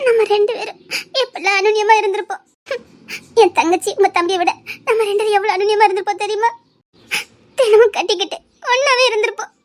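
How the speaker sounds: crying over the life they lost; wistful words between sobs; tearful longing. Acted. Woman pictures married closeness with her lover, crying. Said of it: "சரி, அழாத".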